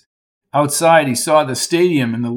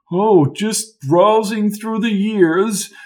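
whimsical; 7 semitones higher, slower than usual. A narrator's reading. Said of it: "he said in a whimsical voice".

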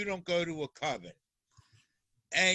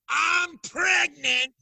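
much higher and louder, slow yelled announcement; top of her lungs; 9 semitones higher, 8 dB louder, much slower than usual. Retold or imitated. [name] mimicking Monica yelling her news in the store. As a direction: belt it out across the whole store. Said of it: "on top of her mouth, lungs".

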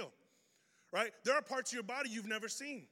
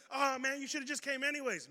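mock whiny friend; 6 semitones higher, 3 dB louder than usual. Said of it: "I hate when people do that".

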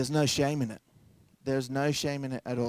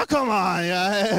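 higher, louder, hammed-up groan of protest; husband pleading with his wife, mock exasperated; mock exasperation; 6 semitones higher, 5 dB louder, slower than usual. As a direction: play the fed-up husband.

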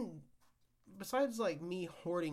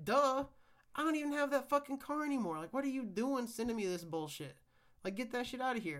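exasperated rant; 7 semitones higher, 4 dB louder than usual. Real, not acted. Ranting at the DMV letter.

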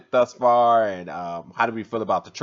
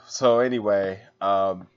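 ordinary delivery

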